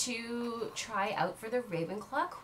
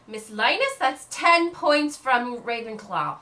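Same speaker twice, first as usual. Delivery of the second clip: louder, slow reprimand; stern teacher; 2 semitones higher, 8 dB louder, slower than usual. Acted. Madame Hooch punishes Aurelia, stern and slow. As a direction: dock the points firmly. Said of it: "She says".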